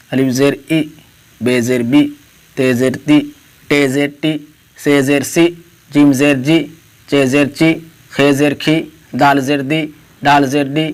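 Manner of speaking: each letter name, then zer, then the ee sound, spaced out; zer drill. Shown as a demonstration. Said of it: "دیکھیے".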